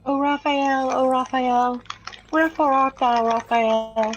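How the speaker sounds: theatrical Romeo calling up to a balcony; mock Shakespearean lament. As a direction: call for Raphael like a lovesick Romeo.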